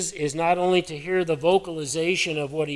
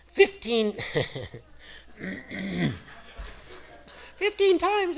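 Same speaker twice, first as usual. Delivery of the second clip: squeaky high-pitched voice breaking; voice cracking high; 6 semitones higher, slower than usual. Real, not acted. Preacher's voice cracking on the count. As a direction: voice jumps way up as it cracks. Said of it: "I try to have a manly voice".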